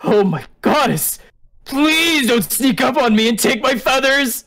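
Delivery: high, drawn-out panicked plea; acted jumpy terror; frightened yelping. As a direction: plead in a startled, hammed-up fright. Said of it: "He yelps in fear when he notices".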